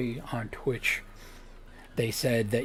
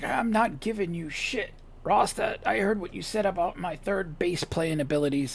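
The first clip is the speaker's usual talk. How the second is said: Billy T's voice; 7 semitones higher than usual. Retold or imitated. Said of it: "He's like".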